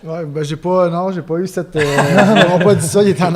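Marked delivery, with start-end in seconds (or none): 1.8-3.3 parler fort à travers le rire; éclat de rire. Real, not acted. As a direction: rire franchement en racontant. Rit en racontant. (en riant fort)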